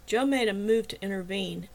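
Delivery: ordinary delivery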